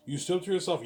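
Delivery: high, loud disbelieving question to himself; surprised self-question. Acted. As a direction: play the impressed listener asking back.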